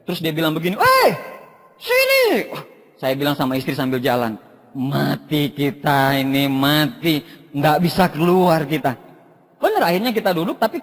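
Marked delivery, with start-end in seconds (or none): 0.8-2.5 summoning someone over in a high voice; high, insistent beckoning; high-pitched call. Retold or imitated. Upline calls out to latecomers. 4.7-9.0 low, slow resigned groan of words; mock dread, drawn out low; doomed mutter. Muttering dread to wife while walking.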